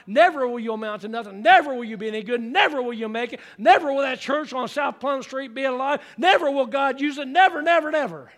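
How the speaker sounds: loud, rising, hammering preacher cadence; preacher building the list to a climax; preaching at full force. Real, not acted. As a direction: pound each never harder.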